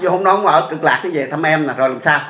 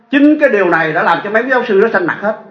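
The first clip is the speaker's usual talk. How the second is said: normal talking voice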